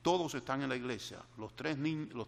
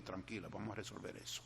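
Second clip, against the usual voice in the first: soft, low, calm reassurance; soothing father; 4 semitones lower, 11 dB quieter, faster than usual. Retold or imitated. A father calms his crying daughter in her room, soft and reassuring. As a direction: gently settle a crying child.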